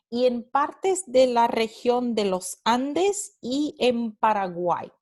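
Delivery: ordinary talk, nothing marked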